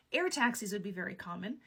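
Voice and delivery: normal talking voice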